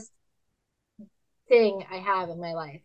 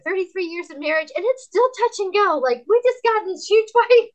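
high, loud comic exasperation; worked-up retelling, rising to a loud peak; exasperated venting; 11 semitones higher, 8 dB louder than usual. Retold or imitated. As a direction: rant it with the pitch way up. Exasperated confession about her own marriage.

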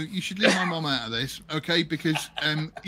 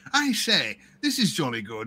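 lightly imitated old-film English accent; a touch of plummy Ealing-film English; old Ealing comedy Englishman. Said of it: "every single bloke in them were like".

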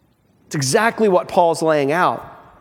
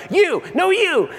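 pitched-up mock toddler squabble; childish bickering; 9 semitones higher than usual. Preacher mocking adults who bicker like toddlers, in a higher childish tone. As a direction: play a two-year-old shooting it back. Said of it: "this is like two-year-olds".

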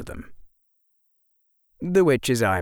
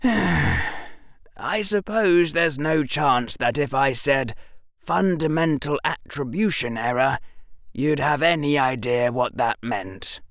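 put-on higher-pitched boy, precise and resigned; boy hero; 7 semitones higher than usual. A narrator's reading. Boy doubting his professor knows the term, resigned.

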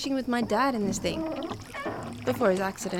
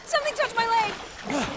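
frightened; 8 semitones higher, slower than usual. Acted. In the water, frightened.

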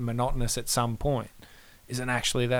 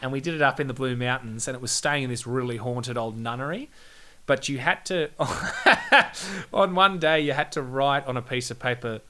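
ordinary talk

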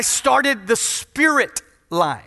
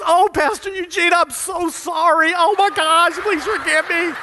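higher, mock-flustered church lady; imitating church lady; 6 semitones higher than usual. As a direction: ham up a caught-out churchgoer apologizing. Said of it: "a lady from our church comes up and goes".

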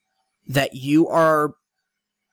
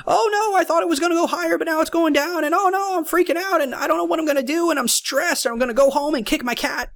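very high, fast, panicky mock voice; hammed-up panic over a falling chart; freaking-out trader; 12 semitones higher, faster than usual. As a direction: squeal like a trader losing it. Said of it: "I wanted to do this one".

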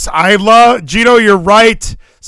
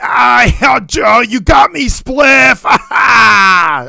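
mock promo shouting; 4 semitones higher, much slower than usual. Mock wrestler promo banter.

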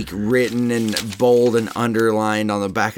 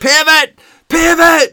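very high, loud repeated yell; yelled impression; 10 dB louder, slower than usual. Ross yelling while carrying a couch upstairs, high and loud. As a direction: yell it like a frantic sitcom mover. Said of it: "Ross keeps yelling".

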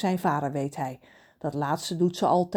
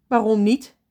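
a stern louder demand; stern mother; 4 semitones higher, 8 dB louder than usual. Acted. Mother sternly questioning Ron on the phone, louder. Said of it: "Daar is haar strenge stem".

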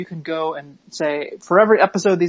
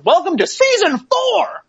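high, slow, big announcer call; 13 semitones higher, 5 dB louder, slower than usual. Acted. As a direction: announce it to a cheering crowd. Announcer-style hype.

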